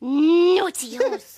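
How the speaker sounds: mock scolding. Scolding the horse, slow.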